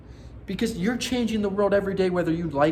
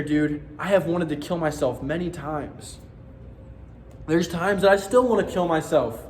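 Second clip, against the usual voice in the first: ordinary talk, nothing marked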